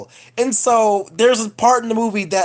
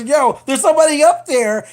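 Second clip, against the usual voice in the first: higher, urgent alarmed call; 8 semitones higher, 4 dB louder than usual. Acted. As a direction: warn them in a rush. The babysitter voiced in alarm.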